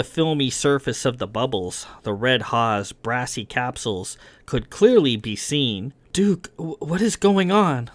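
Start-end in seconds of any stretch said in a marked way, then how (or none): 6.1-7.9 high, strained question; tense, higher voice through locked teeth; through frozen teeth. A narrator's reading. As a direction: stammer it without moving the jaw. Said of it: "asked through frozen teeth".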